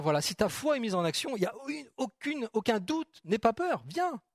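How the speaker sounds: high, eager calls to step out; confident encouragement. Retold or imitated. Preacher voicing Jesus urging Peter onto the water eagerly. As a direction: urge him over the side, no doubt. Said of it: "pour moi, c'était une évidence pour Jésus de dire".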